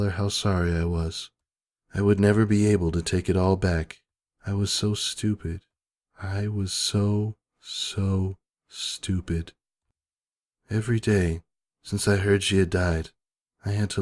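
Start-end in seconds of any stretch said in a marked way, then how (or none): 4.5-9.5 soft, slow, quiet self-blame; almost breaking down; broken regret. Real, not acted. Narrator blaming herself for her friend's death.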